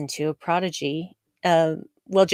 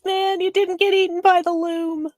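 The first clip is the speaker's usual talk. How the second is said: high, loud cheer for the bell ringer; excited cheer; 11 semitones higher, 6 dB louder than usual. Pretend. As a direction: cheer him on like a fan.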